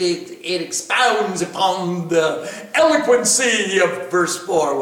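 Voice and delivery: normal talking voice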